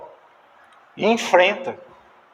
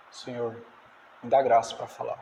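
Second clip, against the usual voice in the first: quiet prayer; 7 semitones lower, 10 dB quieter than usual. Retold or imitated. Neemias prays silently.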